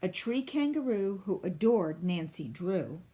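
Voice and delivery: ordinary delivery, nothing marked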